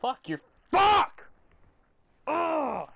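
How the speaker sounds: loud, high, slow raging curse; furious shout. Real, not acted. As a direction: let the rage burst out. Raging after losing zone.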